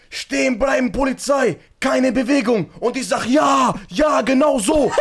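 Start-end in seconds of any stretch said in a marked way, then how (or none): 0.1-2.7 barking police commands; police shout. Retold or imitated. 3.3-4.9 excited cheer. Retold or imitated. Cheering on the actors.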